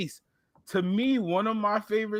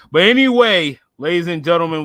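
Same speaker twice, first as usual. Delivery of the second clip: hype host; 9 dB louder than usual. Acted. Host kicks off the show.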